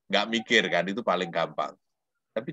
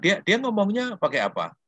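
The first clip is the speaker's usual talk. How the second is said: teacher asking; 11 semitones higher, 4 dB louder than usual. Retold or imitated. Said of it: "saya bilang".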